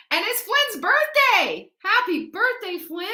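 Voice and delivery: beam a birthday wish up high; excited birthday greeting. Real, not acted. Host wishes a viewer happy birthday, high excited singsong.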